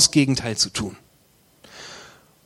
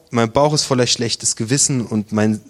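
ordinary talk, nothing marked

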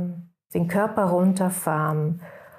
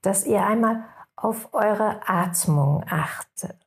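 guided meditation. Acted. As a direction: guide the listeners into rest, unhurried.

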